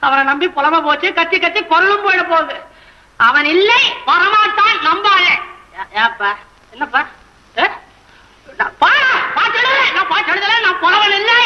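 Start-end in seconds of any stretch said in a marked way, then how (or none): 1.2-5.3 ranting. Acted. Poet rants alone. 8.6-11.4 wailing denial. Acted. Poet wails denial, half laughing.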